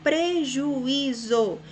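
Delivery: "prejuízo" very slowly as PRE - JU - Í - ZO; syllable by syllable. Shown as a demonstration. Teacher asks how the word divides, syllable by syllable. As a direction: break "prejuízo" into four drawn-out beats. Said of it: "Como se divide a palavra".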